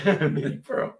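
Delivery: amused echo with a laugh. Real, not acted. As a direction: repeat it through a chuckle.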